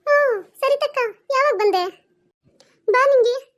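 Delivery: high, bright call of a younger cousin; light, lifted young girl's voice; cheerful cousin greeting. Acted.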